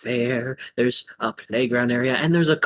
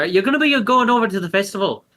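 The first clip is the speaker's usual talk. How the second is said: cheerful waitress; 7 semitones higher, 6 dB louder, faster than usual. Acted. NPC voice, tavern owner.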